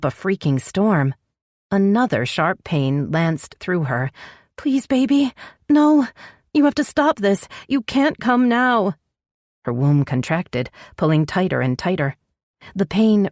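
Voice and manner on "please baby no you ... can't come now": panicked pleading. Acted. Labouring woman begs baby not come.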